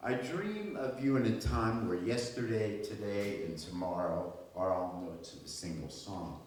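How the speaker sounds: lyrical reading, swelling on openings and dropping away at line ends; poem recitation. Acted. Poet addresses the Chesapeake Bay before a live audience, lyrical recitation. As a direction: recite it as an ode, with deliberate pauses.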